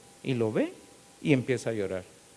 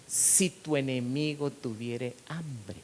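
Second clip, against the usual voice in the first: slow, measured recitation of the verse; solemn scripture reading; slower than usual. Acted. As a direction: read the verse slowly, weighing each phrase. Quoting scripture slowly, solemnly. (predicador leyendo la Biblia, lento y solemne)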